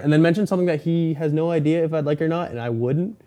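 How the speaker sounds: ordinary talk, nothing marked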